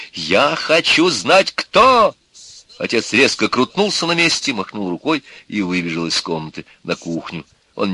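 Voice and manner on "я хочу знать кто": slow, rising to a high yell; demanding shout. A narrator's reading. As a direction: insist louder on the last word. Father demands the name in quarrel. (требовательный крик отца)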